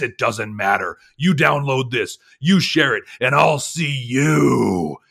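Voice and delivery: loud, hyped wrestling promo shout; pro wrestler announcer voice. Pretend. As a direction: cut a big wrestling promo. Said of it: "the pro wrestler voice announcement".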